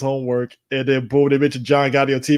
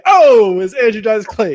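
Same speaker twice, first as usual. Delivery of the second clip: startled; 6 semitones higher, 6 dB louder, slower than usual. Retold or imitated. Imitating scared reaction.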